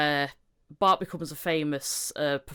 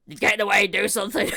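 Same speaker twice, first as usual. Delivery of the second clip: louder, urgent plea to do something; mock pushy parent; 2 semitones higher, 5 dB louder than usual. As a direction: urge the star on like a fussy parent. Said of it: "I'm waiting".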